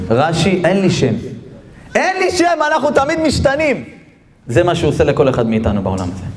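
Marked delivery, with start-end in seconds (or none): 1.9-3.8 high-pitched proclaimed answer; emphatic declaration. Acted. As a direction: declaim it high as the angel's revelation. Angel's answer repeated and driven home. (הכרזה נחרצת בקול גבוה)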